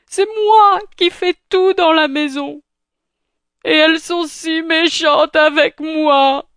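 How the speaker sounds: high, tearful Cinderella voice; crying. Acted. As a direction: sob it out, high and wailing. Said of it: "monta dans sa chambre en pleurant".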